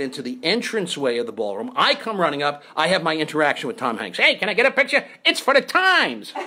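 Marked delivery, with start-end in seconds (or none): 4.2-6.3 put-on high, brash street-kid call; Leo Gorcey. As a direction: holler it, drawing out the last word. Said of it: "I did it like Leo Gorcey".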